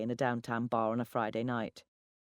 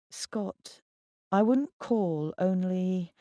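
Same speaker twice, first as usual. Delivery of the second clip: higher, drawn-out words left hanging; dramatic pause; 5 semitones higher, 3 dB louder, much slower than usual. A narrator's reading. Breaking news to an ex by phone, pausing for dramatic effect. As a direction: stretch it out and leave the end suspended. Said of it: "I paused for dramatic effect".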